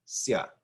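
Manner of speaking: "sia" as a single "sya"; no break between I and A in "sia"; blended vowels. Shown as a demonstration. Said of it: "blend together to make one sound".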